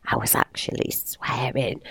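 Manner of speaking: hushed confessional whisper. Real, not acted. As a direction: whisper it like a secret. Confessing swearing under breath.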